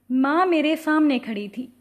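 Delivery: ordinary delivery, nothing marked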